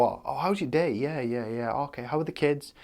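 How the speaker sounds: lightly hammed-up friendly nattering; mock chatty doctor. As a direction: parody a doctor making idle chit-chat. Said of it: "they don't go".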